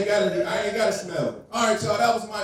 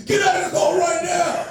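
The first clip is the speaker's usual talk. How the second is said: loud, higher barked order; cop shouting; 10 semitones higher, 4 dB louder than usual. Retold or imitated. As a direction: yell like a cop at the stop. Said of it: "I get put over for a traffic ticket".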